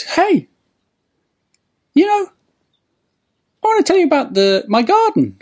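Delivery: very high, chatty falsetto; little old man. Retold or imitated. Old man tells tramp about his garden, high falsetto. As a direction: chat away as a piping little old man. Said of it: "it's a little old man".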